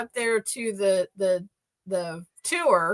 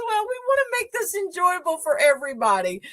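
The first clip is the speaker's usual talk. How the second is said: imitating tour guide; 8 semitones higher, faster than usual.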